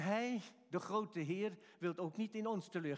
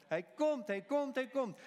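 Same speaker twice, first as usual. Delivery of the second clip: louder, higher proclamation; proclaiming; 7 semitones higher, 5 dB louder, slower than usual. Real, not acted. Preacher proclaiming to the church that He comes, louder and higher. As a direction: build it up like a rallying cry.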